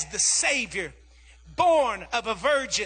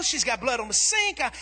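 ordinary talk, nothing marked

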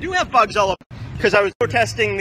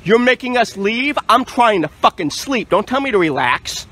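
raised, indignant protest; worked up; 4 semitones higher, 5 dB louder than usual. Real, not acted. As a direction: snap back loudly, refusing to calm down. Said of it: "don't have to get all excited".

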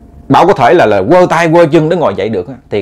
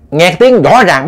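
ordinary delivery

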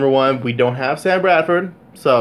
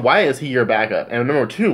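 ordinary talk, nothing marked

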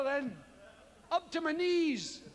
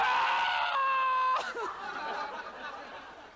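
long high wailing shriek; high shrieking yelp-laugh; 11 semitones higher than usual. Retold or imitated. Icy wave hits, high long shriek. The other noise appears.

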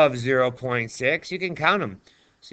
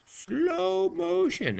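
slow motion; 6 dB quieter, much slower than usual. Shown as a demonstration. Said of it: "going really slow now".

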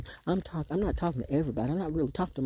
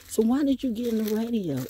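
higher-pitched mock questioning voice; raised voice for the other person's question; mimicked questioner; 8 semitones higher, 4 dB louder than usual. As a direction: imitate someone putting a question to you. Acts out someone's challenging question.

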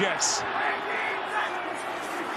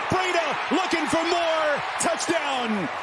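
touchdown call; 7 semitones higher than usual. Real, not acted.

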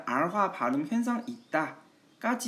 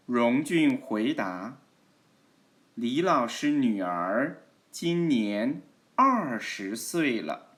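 model reading; much slower than usual. Shown as a demonstration. Teacher reads a dialogue for students to follow, slow model reading. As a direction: read it out slowly for learners to repeat. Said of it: "따라 읽어보세요".

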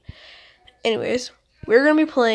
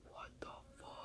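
whispered; 3 semitones higher, 34 dB quieter, much faster than usual. Real, not acted. Startled by a noise.

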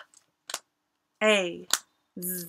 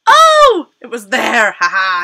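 excited surprise; 14 dB louder than usual. Acted. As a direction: burst out in mock surprise. Game reveal.

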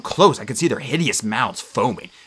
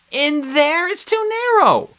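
put-on higher, slower girl's voice, worried; a lightly voiced worried girl; Annabeth's voice; 4 dB louder, slower than usual. A narrator's reading. Narrator voicing Annabeth fretting over the dead.

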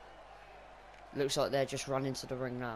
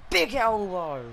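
excited call; 5 semitones higher, 7 dB louder, slower than usual. Real, not acted. Excited call of a big move.

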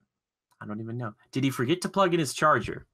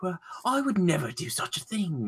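higher-pitched pretend British vampire; mock British accent; 6 semitones higher than usual. Elitist British vampire rejecting the suggestion, in a mock British accent. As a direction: put on an affronted British accent. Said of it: "well, you'd also be British".

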